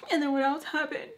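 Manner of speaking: high, imitated anxious question; really scared. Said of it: "really scared".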